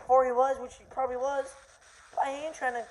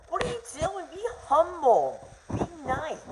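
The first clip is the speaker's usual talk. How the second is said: high, preachy mock voice of a fussy critic; mock scolding critic; 9 semitones higher, 4 dB louder than usual. Vlogger mocking critics who tell players to be humble, high scolding voice. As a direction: whine it like a scold telling someone to behave.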